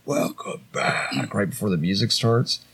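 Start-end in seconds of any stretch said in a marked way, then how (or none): 0.1-1.3 slow, drawn-out whispered words; imitated eerie whisper. The part right before the music starts, whispered. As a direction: whisper it like a demonic album intro. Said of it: "where it's like".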